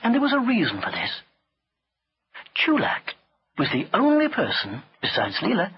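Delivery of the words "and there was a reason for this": ordinary delivery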